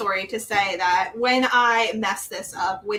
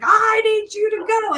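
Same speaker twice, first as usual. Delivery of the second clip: high, loud outburst of lost patience; imitated parent's yell; retold yelling; 11 semitones higher, 5 dB louder than usual. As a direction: re-enact snapping at a child at high pitch. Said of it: "I started yelling and I was like".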